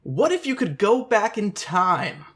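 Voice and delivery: slow, high dramatic villain question; mustache twirling villain. Acted. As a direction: ham it up like a cartoon villain. Dramatic question before evil laughs.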